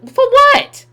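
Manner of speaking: sharp high "for what?"; loud high scoff; incredulous. Real, not acted. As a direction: throw it back, disbelieving. Incredulous retort to quote.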